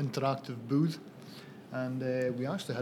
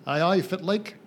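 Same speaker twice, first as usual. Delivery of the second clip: broad Scottish Doric, a little louder; Doric greeting; 5 semitones higher, 5 dB louder than usual. Shown as a demonstration. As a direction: greet them in thick northeast Scots. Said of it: "I would say".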